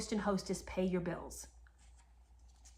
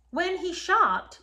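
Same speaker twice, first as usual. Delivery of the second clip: drawn out, higher and louder for drama; slow, raised-pitch setup for the punchline; slow emphatic build-up; 8 semitones higher, 5 dB louder, much slower than usual. Real, not acted. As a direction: lean hard into it, slowly. Setting up an outrage.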